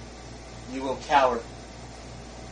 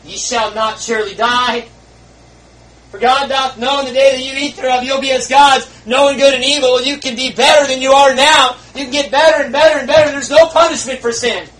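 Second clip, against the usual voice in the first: high loud mocking tempter; voicing the devil; 10 semitones higher, 11 dB louder than usual. Preacher voicing the devil tempting Eve, loud and high. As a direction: shout the devil's lie with glee. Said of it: "the devil says".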